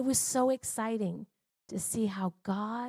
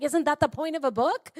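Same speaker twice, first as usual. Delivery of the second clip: raised rising question; retold incredulous retort; 6 semitones higher, 6 dB louder than usual. Husband retorts to his wife about books, raised and incredulous. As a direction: point out the obvious, louder.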